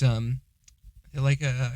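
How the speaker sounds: ordinary delivery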